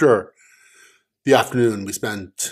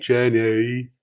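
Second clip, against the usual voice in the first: put-on "Jenny" in a calmer, closer Forrest Gump accent; better Forrest Gump accent. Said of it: "That was better".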